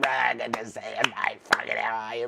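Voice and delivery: normal talking voice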